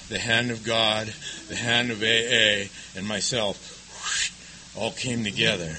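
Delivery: slow, soft and reverent. Real, not acted. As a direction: let it settle, slow and hushed. Reverent moment about God.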